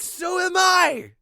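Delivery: hammed-up slow yell; mock shout. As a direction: bellow it out, big and silly. Jeff's outburst in silly tale.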